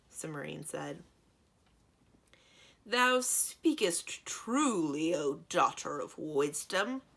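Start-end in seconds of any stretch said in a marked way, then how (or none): 2.8-7.0 loud, slow, otherworldly booming giant; booming djinn voice. A narrator's reading. Djinn answers Cimmerian, slow booming giant voice. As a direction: fill the cave with a slow boom. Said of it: "in a booming voice that filled the cave".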